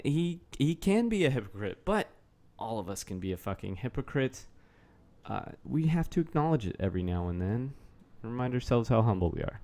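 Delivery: usual speaking voice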